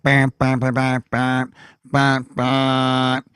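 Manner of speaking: train horn honking a jingle rhythm; shave-and-a-haircut horn. Retold or imitated. Engineer answering a kid's cord pull, rhythmic horn imitation. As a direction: honk out shave and a haircut on one note. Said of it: "always do shave and a haircut".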